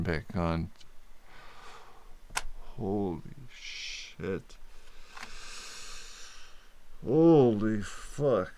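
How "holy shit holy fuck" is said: stunned swearing. Real, not acted. Stimulation switched back on.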